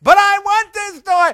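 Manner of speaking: loud, very high tantrum wail; crying child. Acted. Daughter on the store floor before her father, wailing. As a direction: bawl like a child flung on the floor. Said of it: "falls to the floor crying".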